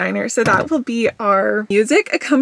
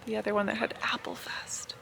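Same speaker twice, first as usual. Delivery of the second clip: whispering; 2 semitones lower, 15 dB quieter than usual. Real, not acted. Quiet aside while filming.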